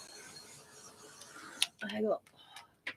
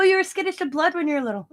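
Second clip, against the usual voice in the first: higher, fast teasing; teasing reminder; 10 semitones higher, faster than usual. Retold or imitated. Grandma teasing granddaughter about blood, fast teasing. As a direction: poke fun at her old fears. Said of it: "I go".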